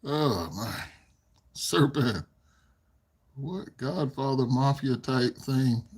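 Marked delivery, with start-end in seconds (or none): none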